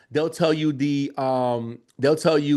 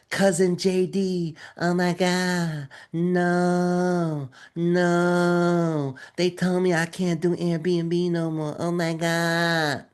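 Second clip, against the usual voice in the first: mock whining, drawn out and slow; whiny complaining student; much slower than usual. Mocking students who write in his DMs whining. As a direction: whine like a helpless beginner. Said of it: "that's how I think they sound when they".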